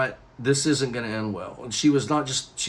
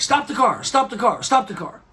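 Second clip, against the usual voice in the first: high, loud repeated cry; urgent demand; 10 semitones higher, 3 dB louder than usual. Retold or imitated. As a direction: yell it like she's about to be sick. Sick date urgently demands stopping.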